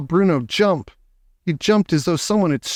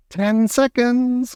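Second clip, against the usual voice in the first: witch crying out; 10 semitones higher, much slower than usual. Acted. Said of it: "cried the Grand High Witch".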